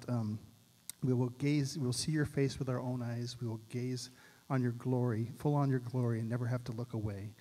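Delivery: usual speaking voice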